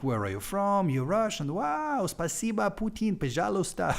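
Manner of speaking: high-pitched imitation of an amazed foreigner; excited foreigner. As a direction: mimic a thrilled tourist, voice leaping way up. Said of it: "some foreigner and he's like".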